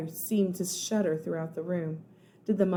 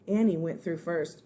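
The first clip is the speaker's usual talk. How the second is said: ordinary delivery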